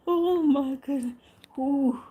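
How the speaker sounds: high, slow laughing exclamation. Real, not acted. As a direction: gasp it out through laughter. Laughing fit.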